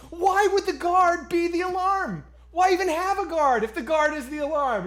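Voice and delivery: high, loud flustered pleading; panicked defense. Retold or imitated. Diner pleading with the angry manager, high flustered panic. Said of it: "I'm like".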